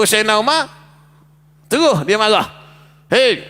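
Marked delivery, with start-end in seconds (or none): 3.1-3.4 harsh hei. Shown as a demonstration. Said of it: "ni mana keras".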